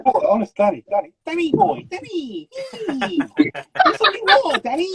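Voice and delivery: squeaky high, teasing calls to Danny; high put-on voice. Teasing Danny about drinking water, high put-on voice. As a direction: push the voice up as high as it goes. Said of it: "as high as I can go".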